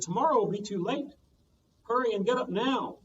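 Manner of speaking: put-on high pressing old man; old man rushing lazy Momotaro, high; urgent. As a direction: hurry him up. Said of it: "The old man said".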